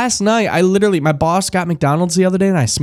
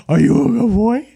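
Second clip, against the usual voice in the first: praising the dog in a higher voice; pet talk; 5 semitones higher than usual. Real, not acted. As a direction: lift it up into sing-song praise. Talking to the dog.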